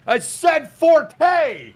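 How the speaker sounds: very high, slow mock yell; yelling husband. Husband defying the court over a word, yelling. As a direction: drag out an indignant high shout. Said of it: "yelling across the room to his wife".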